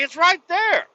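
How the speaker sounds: high, loud insistence; exasperated protest. Retold or imitated. Driver argues with cop over the plate, exasperated.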